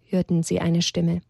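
ordinary delivery, nothing marked

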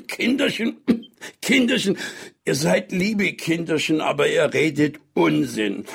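put-on high, hoarse old man; an old man croaking between coughs; hoarse, cough-broken. A narrator's reading. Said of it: "eine heisere, vom Husten unterbrochene Stimme". (heisere, vom Husten unterbrochene Greisenstimme)

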